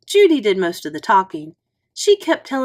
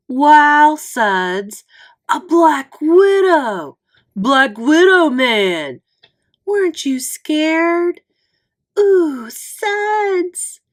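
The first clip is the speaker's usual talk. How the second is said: put-on higher, louder, slow awed kid voices; 7 semitones higher, 6 dB louder, much slower than usual. A narrator's reading. Kids mobbing Suds at recess, awed. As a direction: gush like starstruck schoolkids.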